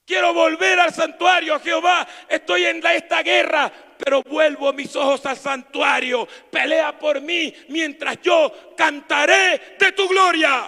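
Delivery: loud, fervent shouted preaching; full-voiced revival preaching; preaching at full force. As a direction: cry it out to the congregation.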